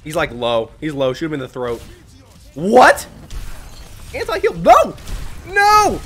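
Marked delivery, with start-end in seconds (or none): 2.5-6.0 sudden high yelps of disbelief; shocked cry. Real, not acted. Gamer hit by anti-heal, crying out in shock. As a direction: cry out in alarm.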